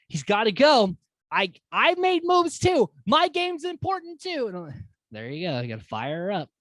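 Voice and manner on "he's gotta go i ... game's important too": high, loud self-defence; indignant, high-pitched outburst; worked-up protest. Retold or imitated. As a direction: argue your case, voice jumping up. Acting Bray firing Beth up.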